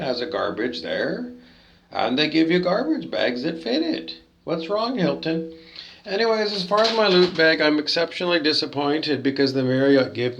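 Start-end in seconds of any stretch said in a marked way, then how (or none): none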